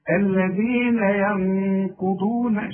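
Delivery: الذين ينقضون with a two-beat nasal ن; slow nasal. Shown as a demonstration. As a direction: stretch and hum the ن. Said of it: "الغنة لا تزيد". (غنة واضحة)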